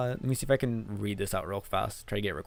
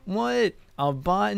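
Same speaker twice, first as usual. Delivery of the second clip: high, loud "What? A bot?"; surprised disbelief; 9 semitones higher, 5 dB louder, much slower than usual. Real, not acted. Streamer defending a viewer called a bot, high incredulous. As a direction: jump up in pitch, incredulous.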